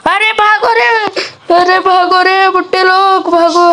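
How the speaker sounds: high, urgent call to run; high panicky run call; panicked calling out. Real, not acted. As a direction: yell to everyone to run. Zombie attacks, calls to flee.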